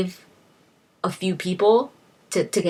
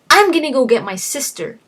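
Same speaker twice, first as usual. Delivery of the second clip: defiant outburst; 7 semitones higher, 5 dB louder, faster than usual. Retold or imitated. Wife defiant in argument.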